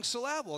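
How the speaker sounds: "syllable" as suh-LAB-ul, middle beat stressed; syllable mis-stressed. Shown as a demonstration. Preacher repeating his running joke, "syllable" said suh-LAB-ul. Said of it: "emphasize the one part of the word".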